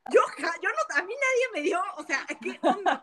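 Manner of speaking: high, loud, incredulous protest; comic outrage. Real, not acted. Mother protesting that nobody warned her about leaking milk, comic outrage. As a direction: protest with laughter creeping in.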